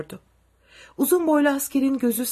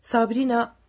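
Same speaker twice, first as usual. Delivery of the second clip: usual speaking voice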